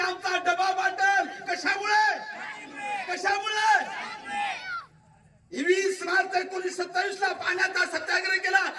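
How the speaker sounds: demanding answers from a silent crowd; preaching at full force. Acted. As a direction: hammer the crowd with each question. Preacher drills crowd with questions.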